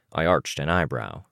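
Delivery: ordinary delivery, nothing marked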